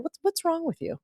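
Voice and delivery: "What's, what's wrong with you?" with a high, mocking lift on "wrong"; mock condescending guy. Mocking the man who asked why she was single, pitched high. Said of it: "a guy saying".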